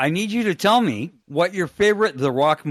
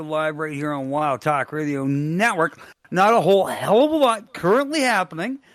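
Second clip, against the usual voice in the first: radio host intro; 6 semitones higher than usual. Acted. Host opening the wrestling radio show, upbeat announcer read.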